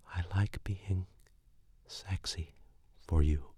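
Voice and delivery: hushed unvoiced whisper; whispered repeat. Girlfriend echoing his line in a whisper. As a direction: breathe the words back to him.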